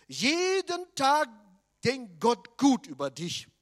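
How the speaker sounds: loud, slow, drawn-out declaration; solemn proclamation. Acted. Preacher announcing the third point to the congregation, slow and loud. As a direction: proclaim each word slowly.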